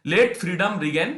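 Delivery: reading the English passage slowly and deliberately; measured classroom read-aloud; slow text reading. Shown as a demonstration.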